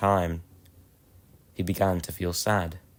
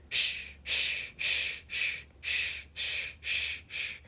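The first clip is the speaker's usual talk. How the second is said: whispered shh puffs fading softer; steam puffing sounds; 8 dB quieter, much slower than usual. Acted. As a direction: puff like a steam engine pulling away. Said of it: "Edward puffed away".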